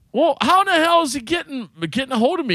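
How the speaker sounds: high-pitched baffled demand; mock-exasperated question; retold exasperation. Retold argument, baffled comeback.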